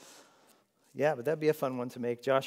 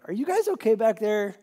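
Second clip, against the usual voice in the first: higher, louder call to the back; 9 semitones higher, 6 dB louder than usual. Real, not acted. Calling to kids at back.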